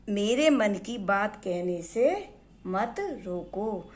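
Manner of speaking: ordinary talk, nothing marked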